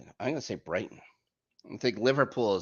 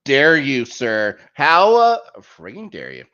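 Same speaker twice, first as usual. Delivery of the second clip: mock outrage; 3 semitones higher, 10 dB louder than usual. Mock outrage at Chelsea insult.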